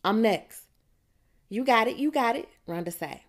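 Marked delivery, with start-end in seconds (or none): 0.0-0.7 loud claim to the next turn; hollering. A narrator's reading. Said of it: "I hollers". 1.5-2.5 high, louder, quick encouragement; excited approval. A narrator's reading. Rhonda cheers Precious's answer.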